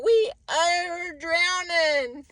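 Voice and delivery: dragged-out mock complaint; slowly hammed-up "we are drowning"; slow mock drowning whine. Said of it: "being all".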